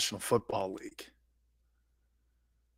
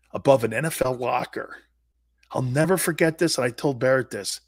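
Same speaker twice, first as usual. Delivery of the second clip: usual speaking voice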